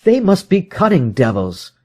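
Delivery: a proud man swearing revenge; Holmes, bitter resolve. Acted. Holmes voiced by narrator.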